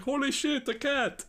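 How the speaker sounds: high squeaky yelp of alarm; high-pitched Rob Schneider impression; quoting Rob Schneider. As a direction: squeal it like a panicked delivery guy. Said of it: "He's just like".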